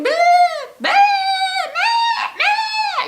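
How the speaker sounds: very high, loud, frantic bleating; imitated baby goat bleats. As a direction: bleat like a screaming kid goat. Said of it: "the baby going".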